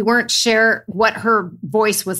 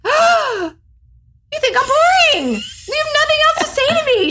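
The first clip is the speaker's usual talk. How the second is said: much higher, mock-offended squeal. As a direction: play up outrage at a dull question.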